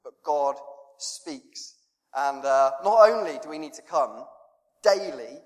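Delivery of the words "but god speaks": normal talking voice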